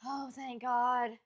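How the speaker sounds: slow drawn-out relief. Retold or imitated. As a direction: melt into it slowly. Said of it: "Just like this".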